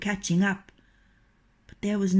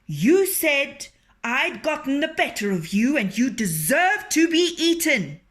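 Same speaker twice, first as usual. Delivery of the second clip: indignant fox; 6 semitones higher, 6 dB louder than usual. A narrator's reading. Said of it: "said Reynard".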